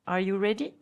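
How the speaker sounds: usual speaking voice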